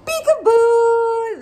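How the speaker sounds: high, loud, slow sing-song peekaboo; playful peekaboo. Acted. Host uncovering the kitten for toddlers, slow high peekaboo. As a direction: stretch it out like a game of peekaboo. Peekaboo reveal in kids' book.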